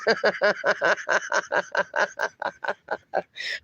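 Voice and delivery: long laugh tapering away softly; real helpless laugh. Real, not acted. Host laughing at a viewer's four-letter joke, helpless giggles. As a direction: laugh it out until it fades.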